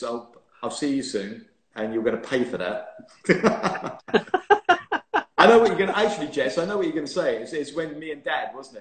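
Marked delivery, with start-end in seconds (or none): 3.2-5.3 high burst of real laughter; big laugh. Real, not acted. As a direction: laugh out loud at the joke. Laughing at his own joke.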